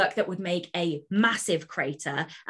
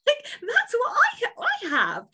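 squealing with laughter, much higher; laughing giddy; 4 dB louder, slower than usual. Real, not acted. Author telling the host her new love, giddy laughing. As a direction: giggle it out, overexcited. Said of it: "I'm on helium now".

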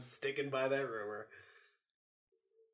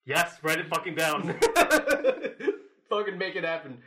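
loud laughing cheer; 3 semitones higher, 9 dB louder than usual. Real, not acted. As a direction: egg them on through laughs. Laughing, egging on.

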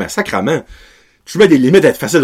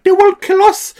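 high-pitched pleading in a mock Mexican accent; 3 dB louder than usual. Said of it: "je vais se faire un accent mexicain". (accent mexicain suppliant, suraigu et caricatural)

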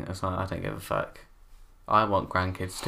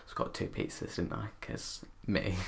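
sheepish whisper; 9 dB quieter than usual. Real, not acted. Confessing to buying two pizzas.

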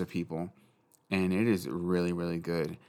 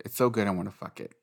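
ordinary delivery

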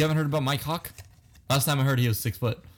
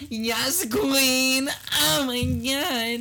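high, drawn-out yes queen; mock camp voice; 11 semitones higher, much slower than usual. Joking with his friend, hamming a camp voice. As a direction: gush it, pitched up and slow. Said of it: "You sound like my girlfriend".

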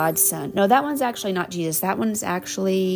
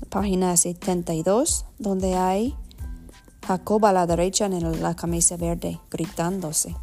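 Spanish with an American accent. Real, not acted. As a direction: read Spanish as an English speaker. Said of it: "perdona mi español".

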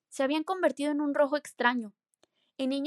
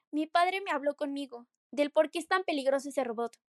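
usual speaking voice

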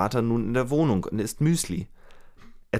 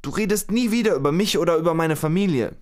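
higher, louder acted threat; angry threat; 7 semitones higher, 4 dB louder than usual. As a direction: retell her warning as a raised, hard threat. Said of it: "und ruft".

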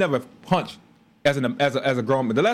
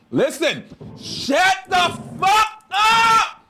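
high, slow, drawn-out shout; yelling at her to shut up and leave him alone; retold furious yell; much slower than usual. Husband retelling how he yelled at his wife to stop.

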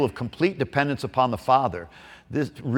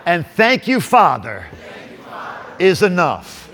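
preaching; 4 semitones higher than usual. Acted. Preacher builds congregation up.

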